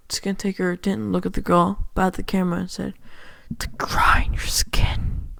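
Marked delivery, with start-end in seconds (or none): 3.6-5.3 slow, drawn-out raspy whisper; menacing puppet villain. Acted. Skin Taker answers the little girl to the camera, menacing whisper. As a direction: whisper it slowly, as a creepy threat.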